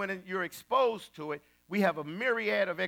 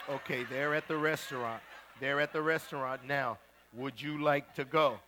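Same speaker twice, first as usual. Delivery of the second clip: lower, measured mock TV host; low-key investigator; 4 semitones lower than usual. TV host asks client about confronting couple, low-key. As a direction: report it discreetly to the client. Acts out TV investigator's calm report.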